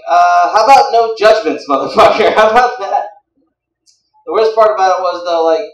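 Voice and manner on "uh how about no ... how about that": loud, irritated comeback; indignant retort. Retold or imitated. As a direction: snap back loudly at a judgmental server. Acted angry retort to deli guy.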